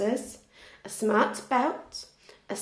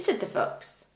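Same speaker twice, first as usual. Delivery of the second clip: ordinary delivery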